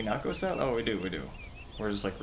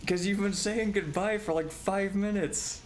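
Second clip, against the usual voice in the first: high-pitched laughing tease; 11 semitones higher than usual. Real, not acted. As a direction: laugh through the words. Laughing at co-host.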